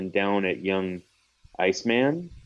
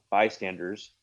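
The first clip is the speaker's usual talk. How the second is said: ordinary talk, nothing marked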